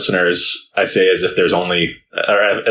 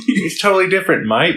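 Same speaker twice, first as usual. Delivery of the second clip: higher-pitched mock Australian accent; playful Australian accent; 9 semitones higher than usual. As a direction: put on a joking Australian accent. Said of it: "I can't do the Australian accent".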